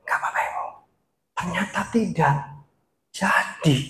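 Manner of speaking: hushed, very soft whisper; whispered emphasis. Shown as a demonstration. Speaker shows another way to stress words, soft whisper. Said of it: "lalu berbisik".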